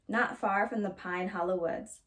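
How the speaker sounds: ordinary delivery, nothing marked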